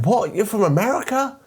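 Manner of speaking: high, hammed-up British girl. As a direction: introduce yourself in a high-pitched British caricature. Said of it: "a British girl who then goes".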